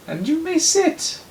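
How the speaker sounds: slow, very high falsetto judge; piping judge granting permission, unhurried; Rachel Lloyd. Acted. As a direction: draw it out in a loud put-on high voice. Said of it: "Rachel Lloyd".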